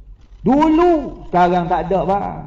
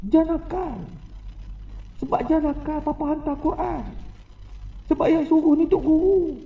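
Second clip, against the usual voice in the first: soft, emotional imitation of a weeping father; tearful plea; 3 semitones higher, 6 dB quieter than usual. Father tells son why he sent him to Quran school, tearfully. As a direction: plead quietly, as if holding back tears. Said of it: "dia menangis".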